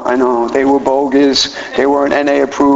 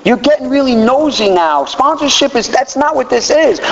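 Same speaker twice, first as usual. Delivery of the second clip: high, suspicious, evasive whine; guard your secrets, then blurt them out; defensive; 7 semitones higher than usual. Retold or imitated. Refusing his sponsor his address, high and suspicious. Paranoid refusal, mocking himself.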